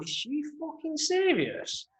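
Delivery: high, drawn-out mock outrage; incredulous retold outburst. Repeats the outraged inner reaction.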